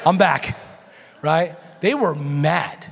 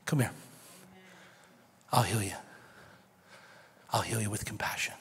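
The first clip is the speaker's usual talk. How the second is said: much softer, lower, hushed invitation; soft, hushed voice of Jesus calling; tender Jesus; 6 semitones lower, 8 dB quieter than usual. Retold or imitated. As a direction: draw them in with a near whisper.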